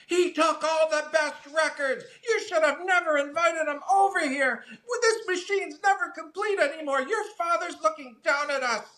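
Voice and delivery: put-on very high, wailing old mother voice; mock the mother's hysterical rant; screaming mother. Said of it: "she started screaming at him".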